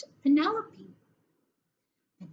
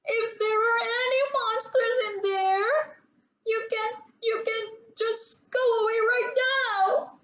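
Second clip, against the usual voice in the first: high, tearful plea to the dark; frightened little girl; 10 semitones higher than usual. Acted. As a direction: beg the monsters to leave, close to crying. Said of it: "she gulped".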